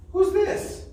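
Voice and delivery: squeaky high guessing-game question; high quiz voice. Shown as a demonstration. Teacher quizzing children in a high playful voice. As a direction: ask the kids like a playful puppet.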